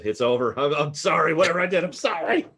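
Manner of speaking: hammed-up, high-pitched pleading; panicked begging. Player begging the stranger for mercy, mock panic. Character begs stranger for his life.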